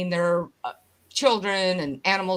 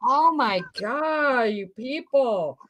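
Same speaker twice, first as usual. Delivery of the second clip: exasperated; 3 semitones higher, much slower than usual. Retold or imitated. Exasperated at fickle chat people.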